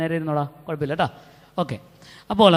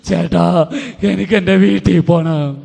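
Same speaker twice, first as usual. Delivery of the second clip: put-on louder, pleading teenage girl wanting home; tearful plea; 6 dB louder, slower than usual. Crying girl begging the counsellor to let her go home. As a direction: beg to go home as if near tears.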